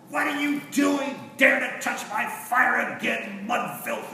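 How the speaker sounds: high, loud, slow furious shriek; witch crying out. Retold or imitated. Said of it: "The witch cried out".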